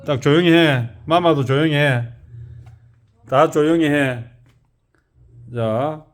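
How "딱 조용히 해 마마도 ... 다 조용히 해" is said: loud, firm telling-off; scolding. Real, not acted. Speaker hushing the household while filming YouTube, scolding. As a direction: hush them loudly and firmly.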